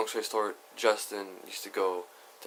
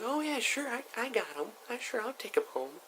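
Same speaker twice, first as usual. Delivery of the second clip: put-on much higher, soft eager kid voice; eager younger self; 12 semitones higher, 7 dB quieter than usual. Young sober [name] agrees to take Carlos home, eager high voice. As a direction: squeak it out like an eager young teen. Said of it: "little sober junior high school [name]".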